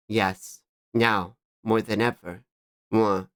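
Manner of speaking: low, understated artist character. Acted. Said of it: "I think that's their voice".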